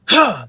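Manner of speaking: short, loud, high "huh"; scream attack. Shown as a demonstration. Coach showing how to execute it, sharp screamed burst. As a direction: punch a burst of air from the diaphragm. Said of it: "that's how you execute it".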